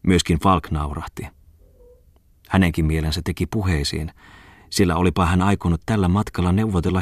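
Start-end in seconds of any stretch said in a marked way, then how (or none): none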